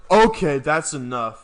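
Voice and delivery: retold stern dad. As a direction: shut it down like a stern father. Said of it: "My dad went".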